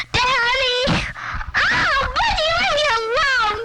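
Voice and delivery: high crying yell. Real, not acted. Little girl crying out at her brother who grabs the mic. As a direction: wail at the pesky brother. Child squabbling with brother over microphone.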